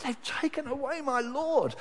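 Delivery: high, grieving lament; anguished cry. Acted. Mary weeping at the tomb, lamenting high. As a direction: let the loss break through. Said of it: "you hear this cry".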